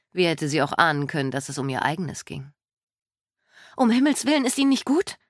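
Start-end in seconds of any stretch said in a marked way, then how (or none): 3.8-5.2 alarmed. Acted. Nurse rushes to help stranger.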